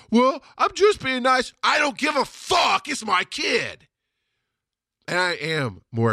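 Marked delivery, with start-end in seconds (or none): 0.1-1.5 high, slow, louder mock whine; mocking excuse. Mocks the excuse people give. 1.6-3.9 high, drawn-out angry shout; aggressive shout. Real, not acted. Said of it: "changed my tone instantly".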